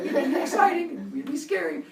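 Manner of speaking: high, laughing, squeaky; excited-scared kid. Retold or imitated. Seven-year-old in the police car, squealing. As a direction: squeal both halves.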